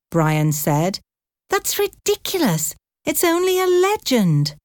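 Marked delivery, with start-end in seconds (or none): 1.5-4.6 put-on much higher, scornful teenage scoff. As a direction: scoff at the idea, voice jumping up. Teen scoffs at leprechaun legend.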